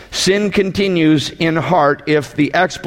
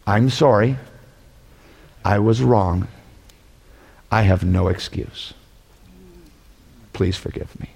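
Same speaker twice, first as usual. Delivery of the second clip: sincere apology; 7 semitones lower, 3 dB quieter than usual. Shown as a demonstration. Preacher modelling the one passing apology, sincere and hushed. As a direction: sound truly sorry, dropping lower each line. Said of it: "This is the only passing apology".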